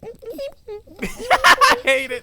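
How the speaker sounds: loud burst of real laughter; laughing. Real, not acted. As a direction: laugh out loud through the words. Reacting to squeak performance.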